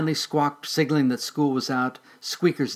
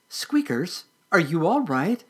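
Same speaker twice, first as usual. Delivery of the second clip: worried mother voice; 6 semitones higher, 4 dB louder than usual. Acted. Mother character voice.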